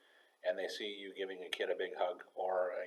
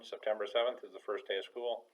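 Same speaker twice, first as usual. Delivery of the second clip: normal talking voice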